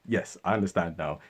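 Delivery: ordinary talk, nothing marked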